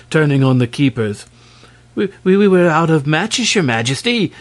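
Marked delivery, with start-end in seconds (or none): 1.9-4.3 higher, frightened stammering; trembling stammer. Acted. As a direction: stammer it out in fear. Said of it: "stammered the trembling grey men".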